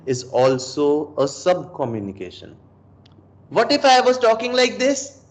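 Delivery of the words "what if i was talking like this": much higher pitch, altered timbre; high voice that undercuts authority; put-on high voice. Shown as a demonstration. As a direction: pitch it way up and lose all authority. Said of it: "This is the tone of my sound".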